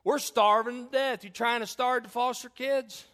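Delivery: high, loud, slow mock yell; yelling child. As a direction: shout it like a cheeky kid from the back seat. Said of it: "She yells this from the back seat".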